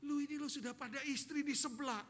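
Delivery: a mock telling-off, not yet shouting; acted scolding. Wife catching husband eyeing another woman, mock scolding.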